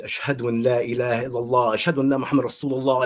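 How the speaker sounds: usual speaking voice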